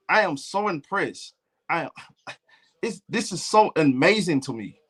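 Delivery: exaggerated proper sports-show host; mock proper talk. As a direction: put on polite, careful commentator talk, mocking it. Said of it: "y'all want me to talk like that".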